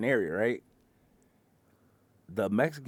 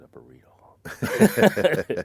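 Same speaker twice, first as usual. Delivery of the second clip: whispered through a laugh; slow punchline whispered while laughing; 5 semitones lower, 7 dB louder, much slower than usual. Real, not acted. Driver delivering burrito punchline, laughing whisper.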